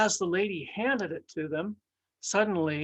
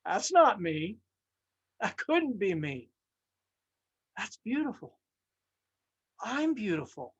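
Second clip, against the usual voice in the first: amazed wonder; 3 semitones higher than usual. Acted. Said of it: "I said".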